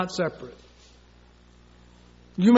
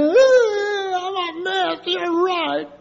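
high whimpering self-pity; hammed-up teary wife giving up; mock crying; 8 semitones higher, much slower than usual. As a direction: whine it through fake tears. Wife's self-pitying plea, voiced.

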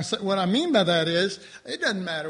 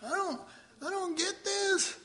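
high-pitched, softer mock confusion; baffled brain; 10 semitones higher, 6 dB quieter than usual. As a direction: play a helpless, overwhelmed mind. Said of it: "my brain was going".

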